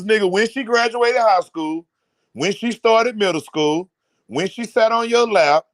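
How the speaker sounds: usual speaking voice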